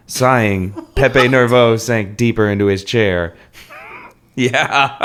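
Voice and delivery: ordinary delivery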